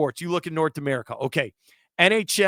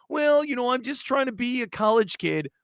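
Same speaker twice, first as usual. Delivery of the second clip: put-on much higher on well, a lightly whiny college kid; mock whiny athlete; 7 semitones higher than usual. Host mocking an athlete's excuse, lightly whiny. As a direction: mimic a player's excuse in a light, put-on whine. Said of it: "Oh, no".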